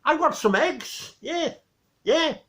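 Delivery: high, slow Geordie boast; drawn-out Geordie sales pitch; Geordie accent. Retold or imitated. Geordie guy selling Victor eggs, thick Geordie accent. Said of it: "couldn't understand his accent".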